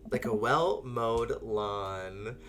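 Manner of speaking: very slow suggestive delivery; slow innuendo. Pretend. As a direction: drag it out suggestively.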